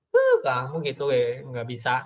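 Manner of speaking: mock-exasperated huff, drawn out; slow, scornful imitation of a parent; belittling parent. Parent to a child asking for help, mock-exasperated. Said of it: "kalimat negatifnya".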